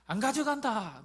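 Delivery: loud playful protest; mock refusal. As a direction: toss the refusal out. Retold refusal again.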